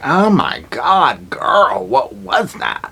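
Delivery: slow, high shocked gasp. Retold or imitated. Friends after the hitchhiker left, high slow shock. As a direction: gasp it out slowly. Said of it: "they're just like".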